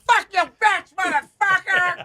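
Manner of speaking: wild high-pitched screaming rant; crazy homeless yelling. Retold or imitated. Mimicking homeless people screaming outside Andy's apartment, wild high shout. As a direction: belt it out like a deranged street screamer. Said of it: "crazy homeless people going".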